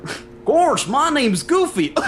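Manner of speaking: very high, slow cartoon goofball voice; cartoon dog voice, high and goofy; Goofy impression. Shown as a demonstration. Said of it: "I can do a Goofy".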